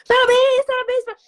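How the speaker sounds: high, loud flurry of congratulations; high-pitched, gushing mock cheering; excited congratulations. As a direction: imitate messages pouring in with cheers. Mimicking incoming congratulation messages.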